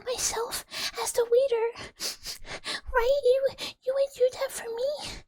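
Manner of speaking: high, teary mock-sobbing plea for a little coup; crying voice. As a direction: beg through fake tears. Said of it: "but crying voice makes me".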